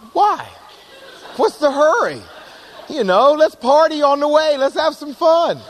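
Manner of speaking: breezy and giggly, no hurry at all; carefree otter. Retold or imitated. An otter laughing off the lion's rush, carefree. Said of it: "otters are like".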